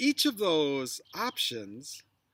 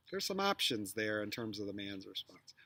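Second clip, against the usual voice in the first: whisper; 7 semitones lower, 7 dB quieter than usual. Real, not acted. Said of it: "unvoiced whisper".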